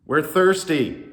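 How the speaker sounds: loud, slow, high whine; really whiny. A narrator's reading. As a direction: whine it like a thirsty crowd. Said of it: "in a really whiny voice".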